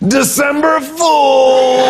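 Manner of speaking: high, slow, drawn-out shout of the punchline; gleeful prank reveal yell; triumphant shout. Retold or imitated.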